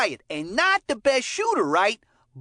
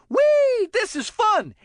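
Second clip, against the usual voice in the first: mock joy; 7 semitones higher, slower than usual. Acting out the joyful meaning of E.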